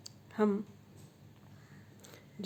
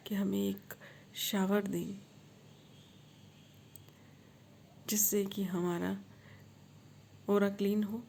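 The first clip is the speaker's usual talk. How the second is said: guided meditation. Acted. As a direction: gently lead them into a visualisation.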